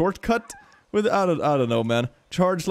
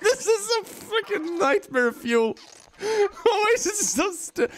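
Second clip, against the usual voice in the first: high, slow words through laughter; helpless laughter; slower than usual. Real, not acted. Player horrified by own creature, helpless laughter. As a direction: squeal it out while cracking up.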